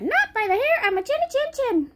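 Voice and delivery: high squeaky piglet pleading; a tiny pig refusing the wolf; little pig voice. Acted. Little pig answering the wolf, high and fast. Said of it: "The little pig said".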